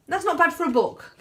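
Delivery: high-pitched, lively selling line; upbeat sales pitch. Real, not acted. Author pitching her cheap book to viewers, high and upbeat. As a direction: pitch it high to win them over.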